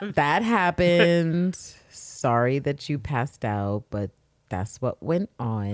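very slow, low mock explanation; drawn-out deadpan. Patient telling the woman she passed out, slow deadpan. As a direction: stretch each phrase out, imitating an unbothered bystander. Acted boy explains the blackout.

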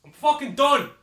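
high, distant yell; angry walk-out. Acted. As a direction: yell it while storming away from the mic. Storms out of the studio.

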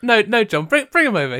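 high, loud pushy repeat; insistent urging. Pretend. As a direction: keep pressing him to play along. Insisting John plays Bruce.

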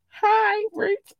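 quick, very high squeak; high-pitched cutesy greeting. Pretend. Podcaster greeting listeners in Ireland, high squeaked hello.

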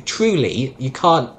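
usual speaking voice

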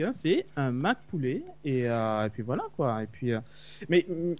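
speaking turned away from the microphone; far from mic. Real, not acted. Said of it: "ton son va et vient". (voix loin du micro)

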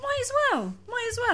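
high, mocking repeat; silly high echo. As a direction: echo it in a squeaky voice. Echoing in a silly voice.

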